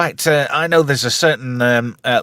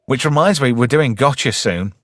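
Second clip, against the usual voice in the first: ordinary delivery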